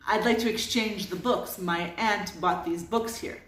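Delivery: usual speaking voice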